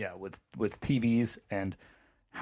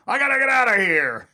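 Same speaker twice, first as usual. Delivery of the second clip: yelling at refs; 11 semitones higher, 7 dB louder than usual. Acted. Irritated fan yelling at the refs to end it, exasperated yell. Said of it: "Yelling at the refs".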